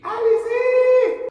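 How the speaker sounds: very high, loud, drawn-out squeal; squeaky shriek. Acted. Squeaky exaggerated outburst.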